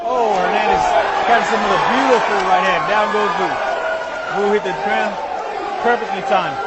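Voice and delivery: loud excited play-by-play; excited commentary. Real, not acted. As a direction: call the knockdown with rising excitement.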